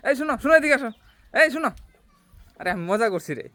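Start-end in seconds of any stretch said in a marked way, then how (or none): 0.1-1.7 yelling across the room. Real, not acted. Calls after wife walking off.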